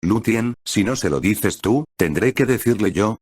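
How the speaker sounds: plain reading voice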